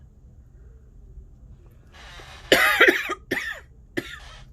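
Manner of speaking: loud coughing fit; harsh cough. Real, not acted. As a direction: hack out a cough. Coughing fit while crafting.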